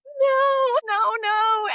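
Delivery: very high, loud drawn-out wail; desperate refusal. Retold or imitated. Girl begging to keep her phone, desperate refusal.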